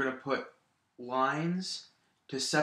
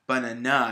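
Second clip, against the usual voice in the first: "banana" as buh-nuh-NAH, final syllable loud; stress on last syllable; 6 dB louder than usual. Shown as a demonstration. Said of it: "put the stress on the last syllable".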